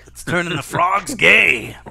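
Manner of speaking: loud, slow hammed-up alarm; mock conspiracy rant. Friends riffing on Alex Jones, loud mock rant. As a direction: bellow it like a frantic radio host.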